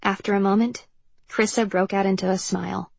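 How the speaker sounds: ordinary delivery, nothing marked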